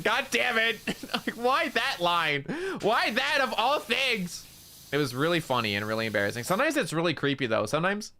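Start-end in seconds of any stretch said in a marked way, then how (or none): 0.0-0.8 mock groan. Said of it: "I was like". 0.9-4.4 high, slow laughing exasperation; laughing disbelief. Real, not acted.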